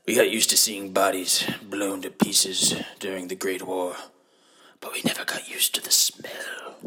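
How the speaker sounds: hushed, grave war-documentary whisper; documentary narrator. Pretend. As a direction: narrate like a solemn war film.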